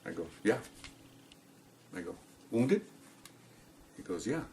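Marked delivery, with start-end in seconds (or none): 0.4-0.6 hushed one-word answer; reply softly, no fuss; quiet, matter-of-fact. Retold or imitated. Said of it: "matter of fact, very quiet". 2.5-2.8 soft question, lifted at the end; quiet, matter-of-fact. Retold or imitated. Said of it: "matter of fact, very quiet". 4.3-4.4 hushed short reply; quiet, matter-of-fact. Retold or imitated. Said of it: "matter of fact, very quiet".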